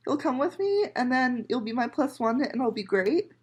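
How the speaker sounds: usual speaking voice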